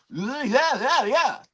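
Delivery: high, squeaky mock yeah-yeahs; sing-song imitation of a yes-man; mock eager yes-man. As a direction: parrot him like an eager fan. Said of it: "And you want me to say".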